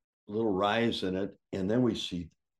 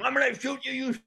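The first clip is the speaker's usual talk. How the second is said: furious threat. Retold or imitated. Wounded wrestler threatening with shotgun.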